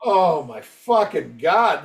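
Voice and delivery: usual speaking voice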